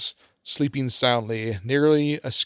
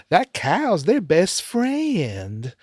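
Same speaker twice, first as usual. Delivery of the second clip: put-on slow Southern accent drawl; 5 semitones higher, much slower than usual. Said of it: "in her southern drawl".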